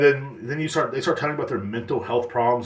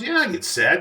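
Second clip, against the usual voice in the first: much higher, a little louder, mocking; mock high answer; 9 semitones higher, 3 dB louder than usual. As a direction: chirp it back in a put-on high voice. Mock dialogue, the other side answering.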